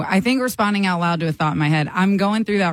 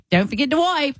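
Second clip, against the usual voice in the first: higher, teasing British-ish roommate voice; a hint of a British accent, higher; roommate's mock accent; 8 semitones higher than usual. Roommate matching her energy, a higher mock British accent. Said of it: "She's like".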